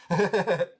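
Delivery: high giggly chuckle; quick amused laugh after the line; short chuckle. Real, not acted. Laughing at own story.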